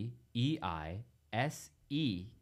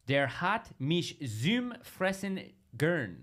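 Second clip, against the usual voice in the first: put-on German accent; 6 semitones higher, slower than usual. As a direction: read the lyric slowly in German. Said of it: "read you some words in my German accent".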